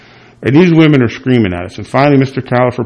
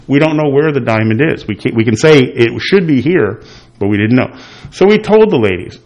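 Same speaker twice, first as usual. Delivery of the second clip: ordinary talk, nothing marked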